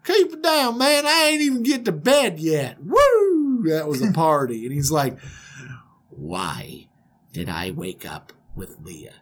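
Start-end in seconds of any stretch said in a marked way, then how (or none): 0.0-3.6 high-pitched country whoop and holler; rowdy, unbothered Southern reveler. Acted. Voices hungover Laban after party. 6.1-9.1 low, soft, deliberate near-whisper; seething restraint. Acted. Groom confronting his tricky uncle, tightly restrained.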